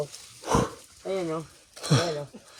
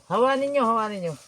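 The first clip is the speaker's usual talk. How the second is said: raised, louder call, easing on the repeat; 6 semitones higher, 6 dB louder than usual. Real, not acted. Guide telling companions to clear the way, calling out. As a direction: call an order to the group.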